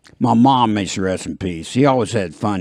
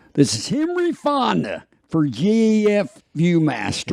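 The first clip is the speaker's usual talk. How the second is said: higher measured ad voice; calm celebrity pitch, raised; Henry Fonda impression; 8 semitones higher than usual. Host redoes the ad quickly, higher celebrity voice. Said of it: "I gotta say the name quick".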